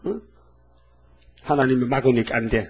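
normal talking voice

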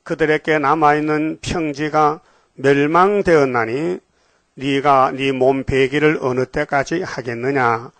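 slow, measured reading of the verses; solemn scripture reading. Acted. Preacher reading Bible verses to the congregation, slow and solemn. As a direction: intone each verse slowly and gravely.

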